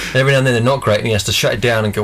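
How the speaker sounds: normal talking voice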